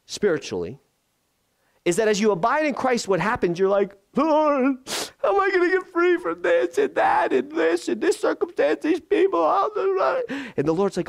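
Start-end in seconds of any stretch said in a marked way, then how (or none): 4.1-10.2 high-pitched, strained cry to God; overwhelmed, very high mock pleading; desperate plea. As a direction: wail it loosely as a hammed-up prayer. Acted desperate plea to the Lord.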